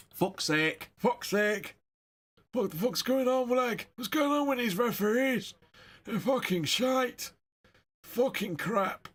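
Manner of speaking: high-pitched, hammed-up Cockney grumbling; bad Cockney accent. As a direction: moan about the ref in a dodgy Cockney voice. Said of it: "That's the worst Cockney accent I've ever heard".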